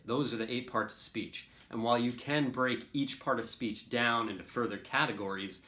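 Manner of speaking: ordinary delivery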